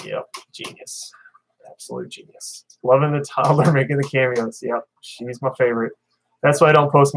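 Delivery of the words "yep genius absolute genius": slow whispered self-mockery; sarcastic whisper. Real, not acted. Muttering to himself about his embarrassing username, whispered. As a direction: breathe it out, dry and sarcastic.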